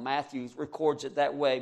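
usual speaking voice

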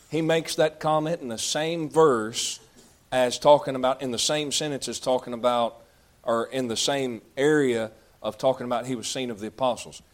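usual speaking voice

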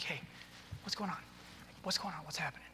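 soft, quiet questions with a lifted pitch; interested. Shown as a demonstration. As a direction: lean in and ask with gentle interest. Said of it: "you're interested".

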